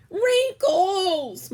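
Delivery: high, loud scream. A narrator's reading. Sister screaming as Clementine flops on her bed. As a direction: shriek the word in horror. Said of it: "Margaret screamed".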